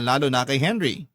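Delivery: ordinary talk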